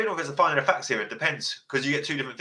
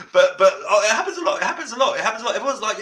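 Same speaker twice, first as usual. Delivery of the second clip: words pushed out through laughter, pitched up; laughing while talking; 8 semitones higher, 6 dB louder, faster than usual. Real, not acted. Host still laughing while describing everyone's reaction. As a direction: keep giggling as you speak.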